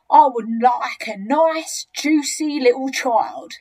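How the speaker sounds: slow, loud, savouring each word; enormous crocodile, greedy relish. Acted. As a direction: draw it out like a hungry crocodile. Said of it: "The enormous crocodile grinned".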